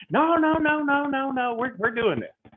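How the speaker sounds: high, insistent string of no's from the client; stubborn client. Retold or imitated. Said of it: "But Mister Garrett, we're wasting time".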